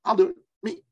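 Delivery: high, squeaky eager volunteer cry. Retold or imitated. Woman volunteering from her chair, leaping at it excitedly. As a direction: jump at the chance, voice soaring up. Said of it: "She's like".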